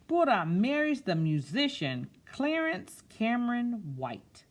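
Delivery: put-on slow, expressive read-aloud to children; storybook reading. A narrator's reading. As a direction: read it like story time, savouring each word. Reads picture book aloud.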